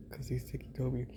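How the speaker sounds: soft unvoiced whisper; hushed joking aside. Real, not acted. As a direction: whisper the name like an inside joke.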